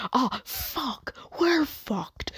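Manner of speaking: high, panicky mock wail; mild panic. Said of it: "slightly panicking in the corner".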